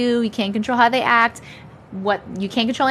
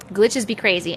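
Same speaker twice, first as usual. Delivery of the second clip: ordinary delivery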